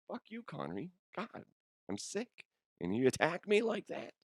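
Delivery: high, hushed, hurt protest; mock wounded. Sick podcaster answering Connery's insult, mock hurt. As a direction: play the offended victim in a small, high voice.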